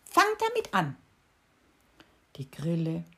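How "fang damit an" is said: much higher squeaky beetle voice, louder. A narrator's reading. Beetle urging the cricket to start, high and pleading. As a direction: pipe up high as the little beetle.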